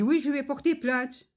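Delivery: high mocking voice of the courier; imitating the courier. Customer mimics the courier threatening a complaint. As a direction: toss it off like a sulky threat. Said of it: "Il me dit".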